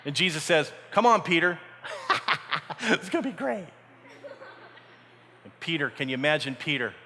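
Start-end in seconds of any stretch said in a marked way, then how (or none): 0.9-3.7 coaxing through a laugh; playful, laughing call to come along; laughing invitation. Acted. Acts Jesus laughing, inviting Peter.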